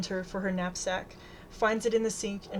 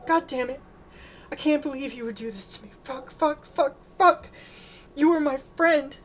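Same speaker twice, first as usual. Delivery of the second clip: higher, tearful angry outburst; distraught sobbing shout; shouting through sobs; 6 semitones higher, 5 dB louder than usual. A narrator's reading. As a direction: cry out in anguish, building louder. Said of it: "shouts through sobs".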